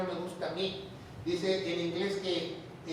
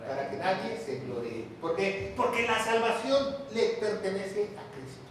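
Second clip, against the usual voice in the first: ordinary talk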